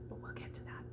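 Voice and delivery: quick whispered aside; whispered promise to the class. Real, not acted. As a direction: breathe it as a secret. Aside to viewers.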